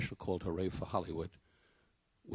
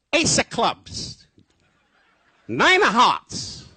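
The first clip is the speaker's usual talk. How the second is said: high, loud, slow calling out; hammed-up shout across the room; yelling out cards; 15 dB louder, much slower than usual. Don calling cards offstage during the act, loud yell. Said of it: "he was yelling out his card game".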